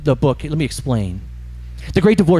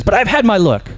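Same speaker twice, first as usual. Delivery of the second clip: high, pushy complaining; irritated ghost; 7 semitones higher than usual. Acted. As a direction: grumble at the spirit, pitched up. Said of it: "they're always angry".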